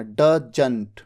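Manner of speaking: teacher models doesn't, ज with a dot below; correct doesn't. Shown as a demonstration. Teacher shows students the right doesn't. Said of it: "जबकि ज कहते हैं".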